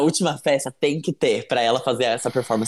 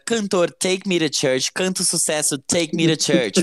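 slow, higher mock news read; news headline read; 4 semitones higher, slower than usual. Reads fake headline.

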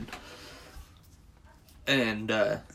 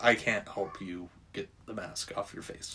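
much softer, lower grim refusal; grim shopkeeper; 4 semitones lower, 10 dB quieter than usual. Retold or imitated. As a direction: refuse in a low sinister murmur. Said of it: "he goes".

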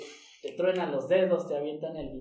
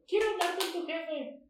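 higher mock Karen demand, words falling at the end; entitled Karen; 12 semitones higher, 4 dB quieter than usual. Friend mocks a customer demanding the boss, higher entitled voice. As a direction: play the demanding customer asking for the manager. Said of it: "nunca te han dicho".

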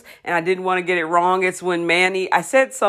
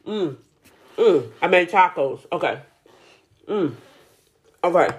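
ordinary talk

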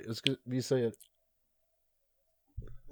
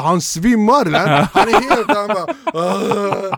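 high, laughing mock quote; laughing while imitating; laughing retelling; 5 semitones higher, 4 dB louder, slower than usual. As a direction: giggle through the retold words. Retelling a fainting kid, laughing.

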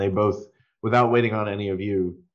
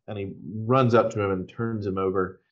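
ordinary talk, nothing marked

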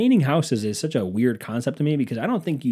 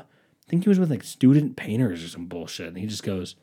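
normal talking voice

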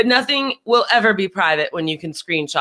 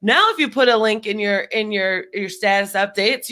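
ordinary talk

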